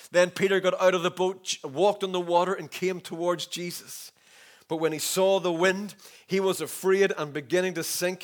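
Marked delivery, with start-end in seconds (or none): none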